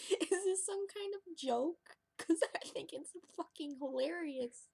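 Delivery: high, slow, laughing disbelief. Real, not acted. Mocking the voice in his head, laughing high. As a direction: giggle through the question.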